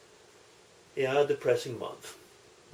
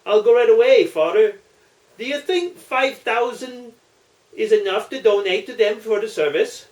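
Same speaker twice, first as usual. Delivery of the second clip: eager Muldoon; 7 semitones higher, 7 dB louder than usual. Acted.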